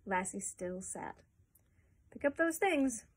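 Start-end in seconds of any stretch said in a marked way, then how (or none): 2.1-3.1 mild thunderous order. A narrator's reading. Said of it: "ordered in a thunderous tone".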